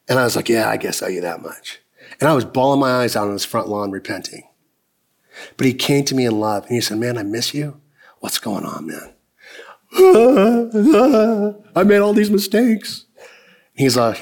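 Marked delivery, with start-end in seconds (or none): 0.5-1.8 low, much softer, giving in; quiet, resigned reply. Retold or imitated. Young man reluctantly agreeing to visit his friend, low and subdued. 7.0-9.1 low, very soft, full of love; tender. Retold or imitated. Friend tells the wandering young man he misses him, softly. 11.7-13.0 emotional, near tears. Retold or imitated. Recalling his repentance.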